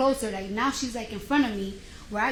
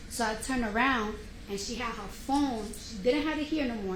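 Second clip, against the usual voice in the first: ordinary delivery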